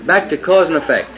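high, loud pulpit preaching; fiery preaching. Acted. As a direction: thunder it from the pulpit. Preaching at full force.

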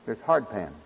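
softly echoed emphasis; hushed echo. Real, not acted. Repeated softer, echoing.

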